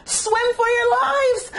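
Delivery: very high, loud, slow alarm; panicked cry. Acted. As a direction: scream a warning to all. Panicked fish flee squid.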